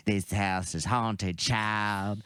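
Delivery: creepy narrator, slow and low; imitated creepy ghost-show narration; Zelda Rubinstein. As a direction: draw it out like an eerie horror-show voiceover. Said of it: "that creepy voice that would always be".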